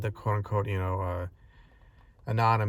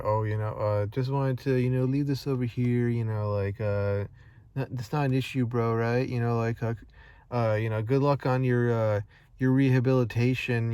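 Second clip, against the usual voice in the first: higher-pitched, falsely friendly voice; mock nonchalance; 4 semitones higher, 4 dB louder than usual. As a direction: act out a careless, insincere well-wisher. Acting out someone taunting a recovering alcoholic.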